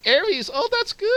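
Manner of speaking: high, loud mock laugh running into the words; imitating laughter. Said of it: "And he was laughing".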